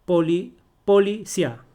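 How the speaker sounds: "policía" as poli, then sia in one loud beat; wrong diphthong. Shown as a demonstration. Teacher showing what you don't say, "policía" ending in one-beat cia. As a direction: run the last vowels of "policía" together as cia. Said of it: "Tú no dices".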